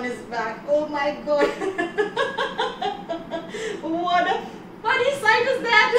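high, giggling mockery; mock the god through laughter; teasing giggle at a funny sight; laughing moon. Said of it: "The moon started laughing again".

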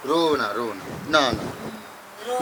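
soft, slow hushing comfort; softly soothing a weeping uncle; gentle consoling. Real, not acted. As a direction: ease him out of his tears, very slowly. Consoling a crying old man.

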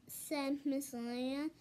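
plain reading voice

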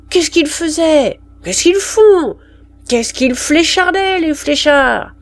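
high-pitched, pressing interrogation; insistent questioning. Acted. Sergeant questioning a woman about her parents, insistent.

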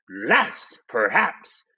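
ordinary delivery

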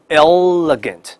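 Elegant as EL-luh-gant, dark L held; slow Elegant, lingering on the L; American double L. Shown as a demonstration. Teacher stresses an important word, slow double L. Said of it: "但是美音叫两个elegant".

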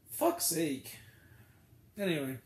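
usual speaking voice

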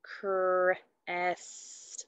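Crest whispered slowly, sound by sound; whispered segmenting. Shown as a demonstration. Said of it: "There's five sounds".

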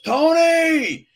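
high, loud drawn-out shout; calling across the room. Retold or imitated. Groomsman yelling across the room to Tony, calling out. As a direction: bellow the name from far off.

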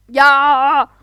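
loud, slow drawn-out scream; scared scream. Real, not acted. Jumping across the glass, a frightened scream. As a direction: shriek in fright.

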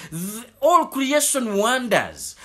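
normal talking voice

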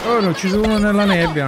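combat callout. Real, not acted. Shooter match, kill in fog.

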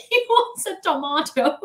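high-pitched "tomato" with a MAH middle; laughing, call out "tomato" as to-MAH-to; exasperated English pronunciation. Retold or imitated. Customer translating for the sandwich man, exasperated high "tomato" with MAH. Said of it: "I, like, yelled it out".

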